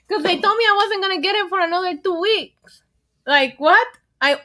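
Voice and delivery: ordinary delivery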